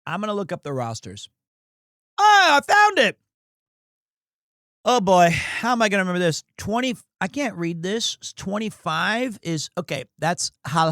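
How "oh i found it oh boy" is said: high, loud exclamation; triumphant outburst, pitched way up; excited discovery. Real, not acted. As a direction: cry out at a sudden find. Finds the rosters he sought.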